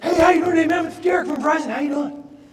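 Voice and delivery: high, loud, beaming sales pitch; overly enthusiastic salesman. Retold or imitated. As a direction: pump every word full of eager cheer. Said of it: "He's so enthusiastic".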